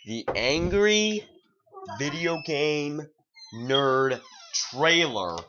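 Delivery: loud, high, slowly stretched announcement; dramatic title reveal. Acted. Vlogger unveiling a film trailer, dramatic title reveal. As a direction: draw out each word like a big unveiling.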